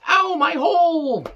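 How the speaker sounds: very high, slow, strained mock wail of pain; mocking Nia Jax. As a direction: ham up a wrestler's whiny cry of pain. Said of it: "Nia Jax again said".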